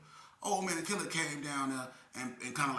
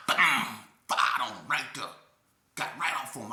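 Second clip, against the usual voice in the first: ordinary delivery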